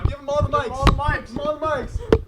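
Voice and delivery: high, loud, playful calling; excited calling out. Real, not acted. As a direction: call him over to the mics, hyped up. Calling kid to the mics.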